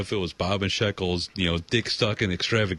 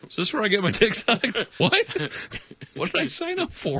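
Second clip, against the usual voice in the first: baffled, pitched way up; confused high voice; 14 semitones higher than usual. Pretend. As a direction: squeak out the confusion.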